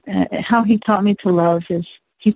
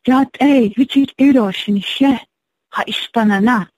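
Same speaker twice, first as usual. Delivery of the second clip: put-on Navajo spoken with a Viennese accent; 4 semitones higher than usual. Elderly man greets waitress in Navajo, imitated Viennese accent. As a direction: imitate a Viennese man speaking Navajo, higher-pitched at first. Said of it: "said in Navajo to me with his Vienna accent".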